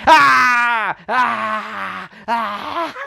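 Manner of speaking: hammed-up high cartoon screams; mock screaming. Reviewer imitating the villain's flip upside down, mock screams. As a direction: ham up a terrified flailing yell. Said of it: "and he's like".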